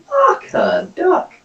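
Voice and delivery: high, slow exasperated curse; comic dismay; stunned disbelief. Real, not acted. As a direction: drag it out in shock. Shocked at damage.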